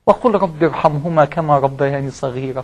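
slow, soft verse recital; Quran recitation. Acted. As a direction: recite the prayer verse quietly and slowly.

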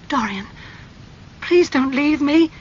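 high-pitched, anguished pleading; desperate cry. A narrator's reading. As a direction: beg him, rising to a loud cry. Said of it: "she cried".

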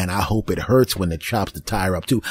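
normal talking voice

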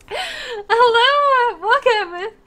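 high, loud hello through tears; tearful greeting. Real, not acted. Streamer greets a newcomer while crying. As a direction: welcome them while crying. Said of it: "you came here and I'm crying".

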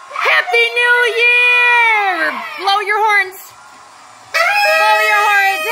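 high, loud, stretched cheering shout; new year countdown crowd. Real, not acted. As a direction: cheer it out at midnight. Cheering at midnight.

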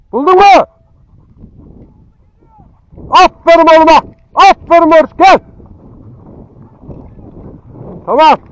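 loud, high shout across the field; calling out. Real, not acted. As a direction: shout praise to the far-off dog and friend. (uzaktan bağırarak seslenme)

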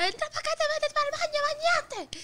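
high, fast scolding mother voice; imitating angry mom. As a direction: bark it out like a mom calling her kid in. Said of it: "Y mi mamá me dijo". (voz alta y rápida de mamá regañando)

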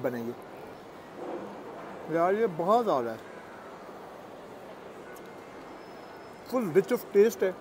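muffled words between chews; talking while chewing. Real, not acted. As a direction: mouth partly full of food. Seen 0: Bites burger just before; mouth closed and working while talking.

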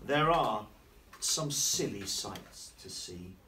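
slow, sing-song picture book reading; reading to children. A narrator's reading. Teacher reading a rhyming book to children, sing-song. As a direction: read the rhyme slowly to the class.

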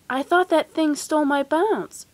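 plain reading voice

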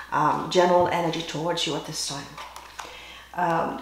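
usual speaking voice

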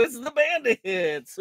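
lightly voiced cartoon line; a slow drawn-out quote. Streamer quoting a kids' cartoon, slow and drawn out. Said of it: "what cartoon that is from".

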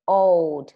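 put-on "Old" said loud, ending on a clearly released D; final d released. Shown as a demonstration. Teacher drills the final D sound for pupils, loudly. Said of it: "Bật âm D cuối nào".

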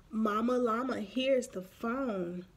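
ordinary delivery, nothing marked

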